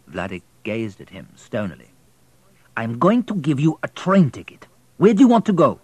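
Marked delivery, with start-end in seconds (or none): 2.8-5.8 louder, higher man's voice; station master offering the boy a ticket; gruff station master. Acted. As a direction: push the boy for an answer.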